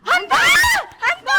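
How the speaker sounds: loud, high startled question; startled cry. Acted. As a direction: yelp the word back in alarm. Startled echo on hearing of ghost. (berseru kaget dengan suara tinggi)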